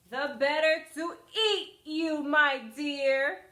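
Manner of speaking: loud, snarling cry; wolf's cry. Acted. Wolf reveals himself to the girl, loud cry. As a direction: pounce with the words. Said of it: "cried the wolf".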